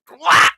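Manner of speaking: high loud squawking quack; mock quack. Real, not acted. Mocking the victim on screen, loud high quack.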